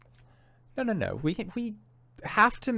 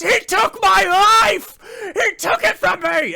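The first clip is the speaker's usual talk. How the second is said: crying yell; 6 dB louder than usual. Acted. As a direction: wail it through tears. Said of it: "he's yelling that".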